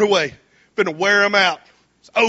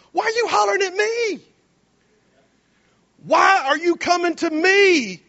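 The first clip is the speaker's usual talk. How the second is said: much higher, incredulous voice; exasperated God; 10 semitones higher than usual. Acted. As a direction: sound baffled at being hollered at. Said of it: "And God goes".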